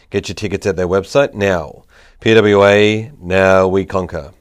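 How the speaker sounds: upbeat announcer ad voice; punchy promo read, selling it. Acted. Sponsor ad read before the show.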